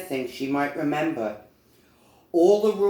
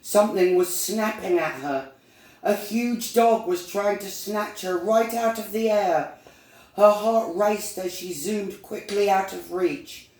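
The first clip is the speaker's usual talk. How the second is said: raised pitch, urgent storytelling; higher, tense narrator voice; alarmed narration; 6 semitones higher than usual. A narrator's reading. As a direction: tell the frightening chase with mounting alarm. Narrating the dog attack.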